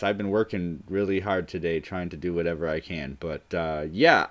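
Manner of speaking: ordinary talk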